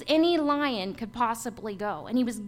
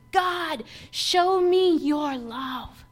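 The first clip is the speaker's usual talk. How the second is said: retold cry to God; 3 semitones higher, 4 dB louder, much slower than usual. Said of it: "I cried out to God".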